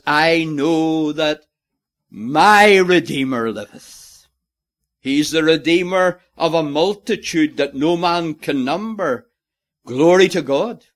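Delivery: loud, fervent sermon delivery; preaching at full force. Real, not acted. Preacher pressing home the point, loud and fervent. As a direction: drive it home to the congregation.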